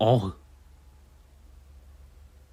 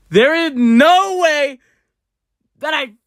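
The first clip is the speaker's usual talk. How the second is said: high, loud and slow; disbelief; 12 semitones higher, 8 dB louder, slower than usual. Real, not acted. Reacting to the friend's prank, shocked high cry.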